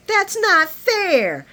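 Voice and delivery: high, drawn-out imitation of complaint; mock whiny protest. Imitating Nina protesting to Carly, whiny. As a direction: wail it out in mock injury.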